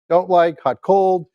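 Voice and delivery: usual speaking voice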